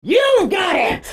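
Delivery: sudden high shout; storyteller's scary punchline yell; jump-scare shout. A narrator's reading. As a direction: leap at the listener with it.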